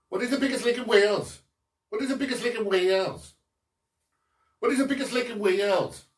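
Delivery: quick, lilting Welsh accent on the quiz question. Shown as a demonstration. Quizmaster repeats the question to his audience in a Welsh accent. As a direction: read it out fast in a put-on Welsh accent. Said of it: "I'll do it in the accent".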